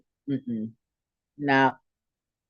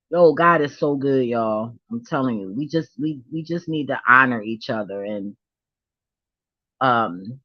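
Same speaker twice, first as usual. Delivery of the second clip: ordinary talk, nothing marked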